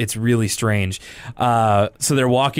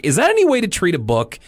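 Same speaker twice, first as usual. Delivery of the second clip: chiding; 7 semitones higher, faster than usual. Retold or imitated. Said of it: "he chides Arthur".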